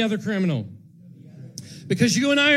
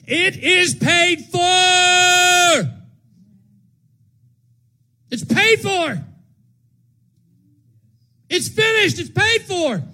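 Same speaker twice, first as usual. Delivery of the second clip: preaching shout, much higher and louder; 11 semitones higher, 7 dB louder, slower than usual. Acted. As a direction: proclaim it at full force.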